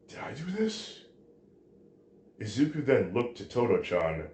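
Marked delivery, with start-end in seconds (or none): 0.1-1.0 hushed, high-pitched astonished question; small, high, bewildered voice; stunned disbelief. A narrator's reading. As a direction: ask it softly, voice lifting in shock. Hero stunned by his own power.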